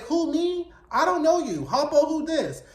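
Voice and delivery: high-pitched mock denial of knowing someone; feigned ignorance. Hawk brushing off Rough Trade from the park, feigned ignorance. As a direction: play innocent, voice jumping up.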